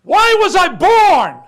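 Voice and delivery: high, slow, very loud scream; screaming at God. Retold or imitated. Boy cursing God after the beating: a slow, high, very loud scream. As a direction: cry out in despair to the heavens.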